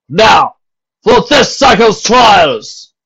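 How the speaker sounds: loud, high, slow proclamation; stern captain. A narrator's reading. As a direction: lay down the law to the crowd. Captain announces trials.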